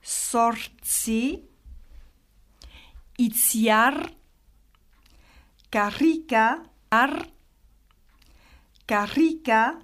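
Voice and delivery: "Sortzi, itziar, karrika, ar" with a strong, long r; hard R. Shown as a demonstration. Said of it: "le R est dur". (« Sortzi, itziar, karrika, ar » : r dur, appuyé)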